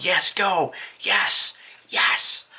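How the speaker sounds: cheering crowd. Retold or imitated. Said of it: "The people behind him are like".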